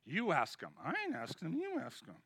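hushed back-and-forth, pushing each other to ask; hushed dare. Acted. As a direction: nudge a friend under your breath. Disciples daring each other.

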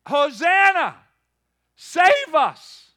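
loud, high desperate cry; yelling out. Retold or imitated. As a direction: shout for rescue at full voice. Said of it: "they were yelling out".

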